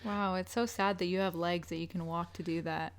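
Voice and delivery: quiet dry sarcasm; mock pity, deadpan and soft; sarcastic. Real, not acted. Sarcastic jab at partner.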